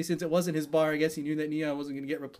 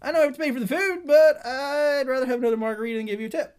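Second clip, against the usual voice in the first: high, loud put-on voice; mock customer; 11 semitones higher, 7 dB louder, faster than usual. Customer explaining to server why no tip, mocking. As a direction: play a silly self-serving customer.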